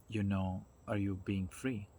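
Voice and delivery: ordinary talk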